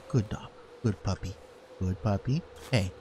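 soft praise. Real, not acted. Musher praising his tired sled dogs, soft and tender. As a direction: coo it gently to a good dog.

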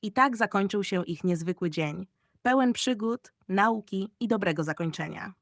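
plain reading voice

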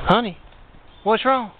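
high-pitched imitation of a concerned wife; wife's voice. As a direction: lift the voice high as the wife asking. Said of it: "she looks at him and says".

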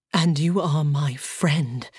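slow, low and intent on friend; fierce. A narrator's reading. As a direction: fight for the word friend. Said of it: "He spoke the word fiercely".

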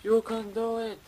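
urgent "you can do it!" rising each time; frantic cheering. Real, not acted. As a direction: cheer yourself on through the chase. Urging his character on in game.

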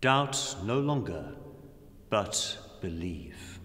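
usual speaking voice

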